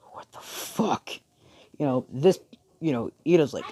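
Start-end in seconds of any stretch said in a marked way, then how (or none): 0.1-1.1 breathy, drawn-out mock disbelief; slow, hushed shock; stunned disbelief. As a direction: say it slowly under your breath, stunned. Retold character reacts in shock.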